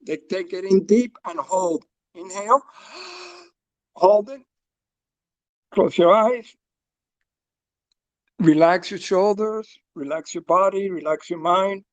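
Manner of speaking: calm, paced breathing instructions; guided breathwork. Acted. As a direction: guide the group through each breath. Leads a breathing exercise.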